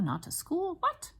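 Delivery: high-pitched playful incredulity; teacher's joking astonished question; mock disbelief. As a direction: squeak it up in pretend shock. Joking disbelief at Jim's choice.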